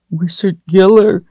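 put-on high, thin child's whine; frightened whine. A narrator's reading. Terrified girl caught by the wizard, whining. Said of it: "she whined".

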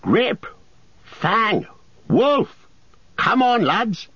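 high calling shout to the dogs. A narrator's reading. Farmer shouting to his dogs, high calling shout. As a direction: call the dogs out loud and high. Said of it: "a loud voice was heard shouting".

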